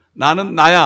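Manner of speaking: booming divine "I am who I am"; God's declaration. Acted. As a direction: proclaim it boldly as God. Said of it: "하나님 그렇게 말씀하십니다".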